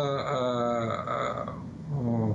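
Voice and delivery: ordinary talk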